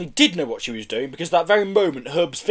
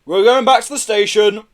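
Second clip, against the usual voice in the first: raised, loud announcement; announcing; 7 semitones higher, 8 dB louder than usual. A narrator's reading. Policeman sending officers back to the station, announcing. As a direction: address the whole squad. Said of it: "he announced back to the police".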